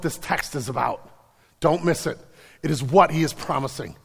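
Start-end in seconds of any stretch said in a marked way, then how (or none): none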